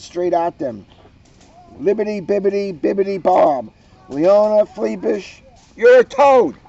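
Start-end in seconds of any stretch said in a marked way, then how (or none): 1.8-6.6 casting a spell. Acted. Mona casting a spell on Leona, chant rising to a loud high curse. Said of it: "she said, and pointed her wand".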